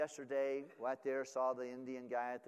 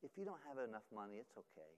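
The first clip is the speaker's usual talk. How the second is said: put-on tender child voice; 2 semitones lower, 7 dB quieter than usual. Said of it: "He said".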